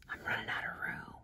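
barely voiced, very soft whisper; whispered aside. Real, not acted. Artist noticing the page is filling up, whispered aside. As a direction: whisper it to yourself. Muttered aside while drawing.

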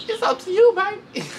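put-on high, sweet taunting "baby"; imitating Jayla. Jayla daring Rolly to fight, in a high mocking coo. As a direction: coo it like a dare. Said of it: "She's like".

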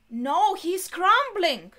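loud, high, slow cry of dismay; dismayed exclamation. Real, not acted. Viewer watching him crumble, dismayed exclamation. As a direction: cry out at what's happening.

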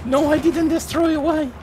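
high frustrated outburst; frustrated cry. Real, not acted. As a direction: exclaim in disbelief. Failed tank kill.

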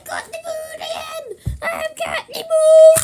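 high, play-acted victory cry; triumphant. Toy villain boasting of getting the moon, triumphant. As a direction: crow it high, ending in a shout.

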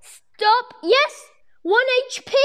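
high, loud frantic cries; frantic excitement. Real, not acted. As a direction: shout in frantic glee. Frantic close fight.

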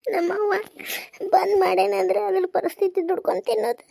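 high, upset, crying voice; tearful lament. Acted. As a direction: lament your lot through tears. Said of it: "ನೀ ಅಳಕತ್ತಿದ್ ನೋಡಿ". (ಅಳುದನಿಯಲ್ಲಿ ಕಷ್ಟ ತೋಡಿಕೊಳ್ಳುವುದು)

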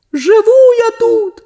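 very high, slow stubborn reply; echo answering. A narrator's reading. The echo answers Sonya from the hatch, high and slow. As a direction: drawl it back from below, high. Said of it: "відповіла луна".

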